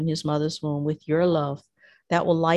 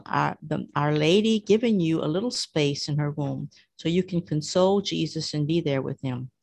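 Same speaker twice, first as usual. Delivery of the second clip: usual speaking voice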